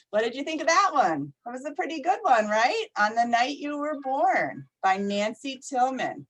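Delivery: plain reading voice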